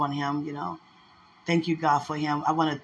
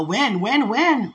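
eager mock pestering, pitch swooping up; sing-song, higher and louder on each repeat; imitating excited mom; 5 semitones higher, 5 dB louder, much slower than usual. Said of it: "she's so excited".

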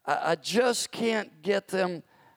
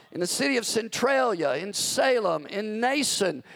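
rising, ringing sermon cry; fiery preaching; 5 semitones higher, 3 dB louder than usual. Acted. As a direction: build it up like a revival call. Preaching builds to full force.

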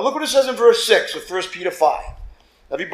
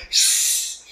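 a sharp seething hiss through the teeth; angry hiss; 3 dB quieter than usual. Retold or imitated. Churchgoer arriving after traffic, a seething hiss. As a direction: hiss like steam boiling over. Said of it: "you're on fire".

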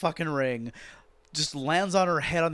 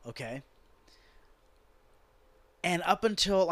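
ordinary talk

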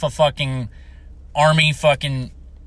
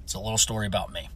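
usual speaking voice

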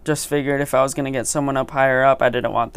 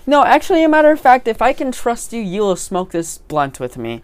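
excited; 7 semitones higher, 5 dB louder than usual. Acted. Offers a blunt, voice jumps up.

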